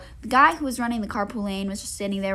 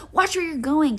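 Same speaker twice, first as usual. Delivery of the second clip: higher, louder rude jab at a celebrity; mock rude snap; 7 semitones higher, 6 dB louder than usual. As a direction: sneer it like bumping into someone on purpose. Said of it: "just purposely bump into Kylie Jenner and be like".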